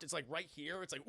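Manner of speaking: ordinary talk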